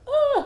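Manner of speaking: short, high, loud "ah!"; mock freak-out cry. Reacting to her own photo, high mock-panicked yelp. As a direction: yelp in comic alarm.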